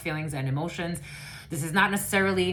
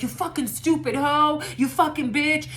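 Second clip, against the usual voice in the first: higher, louder hammed-up yelling; mock insults; 7 semitones higher, 3 dB louder than usual. Reader imitating an enemy confronting the listener, mock yell. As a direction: spit the insults like a bully.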